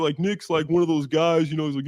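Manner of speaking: normal talking voice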